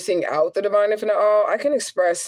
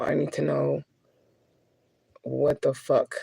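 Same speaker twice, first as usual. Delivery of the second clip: low, offhand what the fuck; casual exasperated cussing aimed upward; tossed-off what the fuck to God; blunt cursing at God; 3 semitones lower, 5 dB quieter, slower than usual. Shown as a demonstration. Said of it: "That's how I talk to God".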